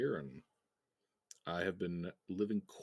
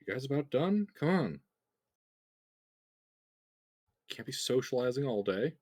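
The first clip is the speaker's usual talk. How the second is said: impatient guard; 6 semitones higher, 4 dB louder than usual. Acted. Guard calls in from door.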